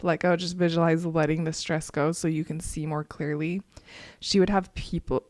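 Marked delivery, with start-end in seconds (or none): 0.0-3.6 a hint of calm guided breathing; lightly guided relaxation. Shown as a demonstration. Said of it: "guides breathing exercise".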